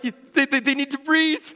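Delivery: high mock sobbing girl's plea; sobbing child. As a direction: plead through tears like a small girl. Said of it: "She's like".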